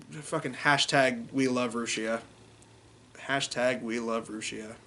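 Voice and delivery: low, soft and deflated; subdued sadness. Real, not acted. Fan mourning a fired VTuber, subdued sadness.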